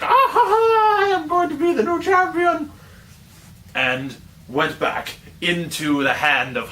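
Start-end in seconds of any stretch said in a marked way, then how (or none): 0.0-2.7 very high, far-off gloating cackle; cackling boomerang. Acted. Boomerang taunting Stacy, very high cackling voice. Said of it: "laughed at it like".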